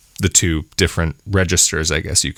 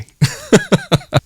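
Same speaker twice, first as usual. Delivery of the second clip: bright laugh; 3 dB louder than usual. Real, not acted. Laughs at own name.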